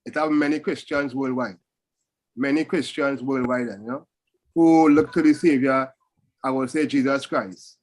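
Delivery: ordinary delivery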